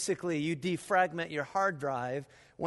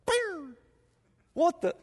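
startled noise; 10 semitones higher, slower than usual. Retold or imitated. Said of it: "I was like".

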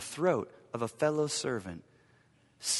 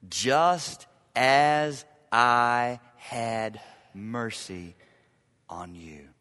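slow emphasis; 3 semitones lower, much slower than usual. Shown as a demonstration. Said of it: "slow emphatic phrase".

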